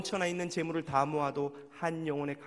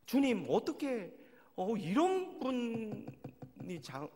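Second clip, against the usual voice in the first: slow, higher, drawn-out grievance; complaining to God; 8 semitones higher, much slower than usual. Retold or imitated. Pastor complaining to the Lord about an elder, slow aggrieved plea. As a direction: plead your grievance, stretched out. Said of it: "막 화가 나가지고 하나님한테 원망을 했어요".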